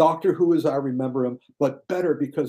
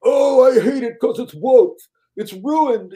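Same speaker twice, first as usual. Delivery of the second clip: high, loud whiny shout of outrage; shrieking mock of a YouTube critic crying woke; 10 semitones higher, 8 dB louder, slower than usual. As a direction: ham up an outraged online critic. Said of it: "are all going".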